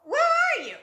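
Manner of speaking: high, loud frantic cry; desperate cry. Retold or imitated. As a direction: call out for the one who left. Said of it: "the next minute you like".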